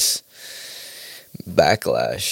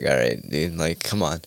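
weary sigh; 6 semitones lower, 6 dB quieter than usual. Retold or imitated. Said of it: "you're just like".

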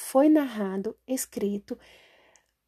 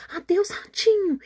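high, alarmed farewell; startled goodbye; 10 semitones higher, 4 dB louder than usual. Acted. Owl startled by the gruffalo, high and alarmed. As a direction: squeal it out and fly off. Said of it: "olhou para o grúfalo espantado".

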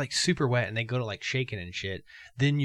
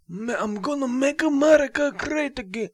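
high, loud put-on Trump voice; hammed-up slogan with a stretched great; Trump impression; 13 semitones higher, 5 dB louder than usual. Retrying the Trump slogan for his cohost, high put-on voice. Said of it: "however he said".

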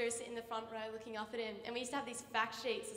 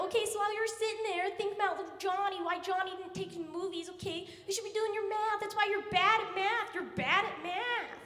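much higher, loud and chirpy; peppy little teacher; 8 semitones higher, 6 dB louder than usual. Retold or imitated. Canadian teacher rousing sleepy class, high and loud. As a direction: pump the class up at dawn.

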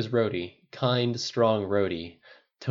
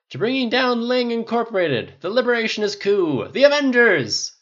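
put-on high, loud drunken proclamation; rousing toast; 10 dB louder than usual. A narrator's reading. Tony toasting his team, glass raised, rousing shout. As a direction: proclaim it to the whole room.

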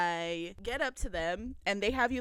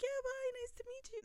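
high, soft, quick farewell; retold soft goodbye; 9 dB quieter, faster than usual. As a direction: imitate a light, hurried goodbye up high.

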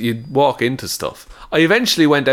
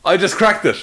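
mock triumphant; 7 semitones higher than usual.

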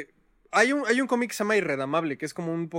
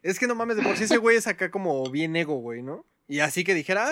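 usual speaking voice

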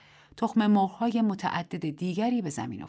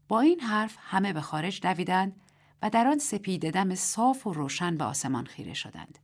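plain reading voice

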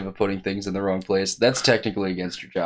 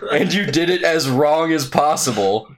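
high, slow mock scolding; hammed-up disbelief; incredulous manager; 7 semitones higher, slower than usual. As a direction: play baffled management, drawing out the words.

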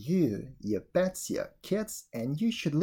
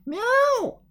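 high, drawn-out cat cries; cat meows; 5 dB louder than usual. Acted. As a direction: meow like a cat, high and long. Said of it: "they cried all together".

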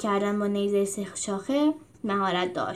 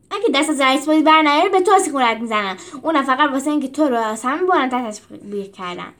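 louder, fast angry threat; angry retort; 3 semitones higher, 5 dB louder than usual. Acted. Rufus angrily threatening Julius mid-fight. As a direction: snap back at the bossy leader.

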